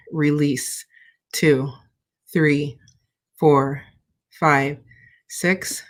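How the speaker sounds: slow, calm counting through the breaths; calm breathing-exercise countdown; guided breathing count. Acted.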